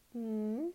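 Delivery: drawn-out "hmm" rising at the end; questioning hum. A narrator's reading. Drugged Anya half hears Hode, humming. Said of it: "hummed Anya".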